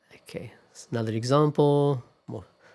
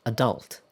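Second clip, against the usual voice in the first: adult stressed on the second syllable; American stress. Shown as a demonstration. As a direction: say adult as "uh-DULT". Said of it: "stress on the second syllable".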